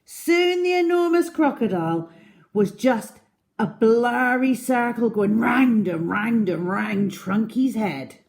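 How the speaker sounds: ordinary delivery, nothing marked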